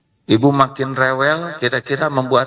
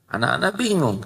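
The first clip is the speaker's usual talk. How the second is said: usual speaking voice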